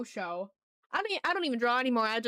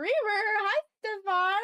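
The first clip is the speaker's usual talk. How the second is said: excited; 9 semitones higher, 4 dB louder, much slower than usual. Real, not acted. Excited greeting to chat.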